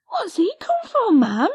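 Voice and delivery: high, loud eager cry; cried out. Acted. Lizzie asks Patty about the dog, a high, eager cry. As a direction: cry out the question breathlessly. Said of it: "she cried".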